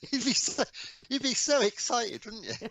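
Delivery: high-pitched, amused and excited; laughing. Real, not acted. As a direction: say it through real laughter, pitch way up. Laughing along at listener's amusement.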